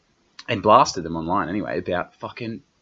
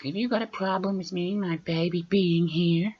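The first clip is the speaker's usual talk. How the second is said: imitating the mother; 12 semitones higher than usual. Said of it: "and just gone".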